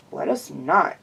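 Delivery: low, dry refusal; low deadpan no; flat rebuttal. A narrator's reading. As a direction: turn her down flatly. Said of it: "I rebutted".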